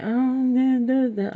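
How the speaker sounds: high, loud mock sob of a whining girl; hammed-up child crying. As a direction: mimic the girl wailing. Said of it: "My daughter started crying".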